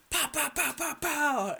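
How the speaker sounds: high mock gun noises, pow pow; gunshot sounds. Gamer imitating the enemy's gunshots, high mock pows. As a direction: spit out quick imitation gunfire.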